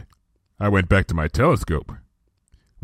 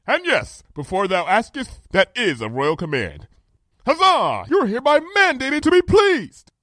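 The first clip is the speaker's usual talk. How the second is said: high, imperious proclamation, brightening to a cheer; composed regal princess; 12 semitones higher than usual. A narrator's reading. Princess issues her friend a royal command, grandly cheerful. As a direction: decree it grandly, ending in a jubilant call.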